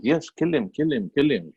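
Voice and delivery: high, eager cheering on a beating; gleeful cheering. Retold or imitated. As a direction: egg him on like a delighted little sister. Little sister cheering on beating.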